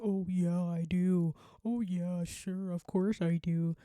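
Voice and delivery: loud, drawn-out mock agreement; sarcastic. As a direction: lay the sarcasm on thick to throw them off. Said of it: "like, the sarcasm".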